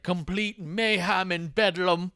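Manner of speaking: high, slow, overwrought mock lament; mock Regal. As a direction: ham up a wailing old wrestler. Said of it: "And he's like".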